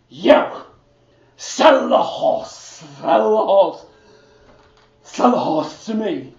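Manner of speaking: put-on the drunk king pushes; drunken demand. The drunken king demands the horse, loud and slurring.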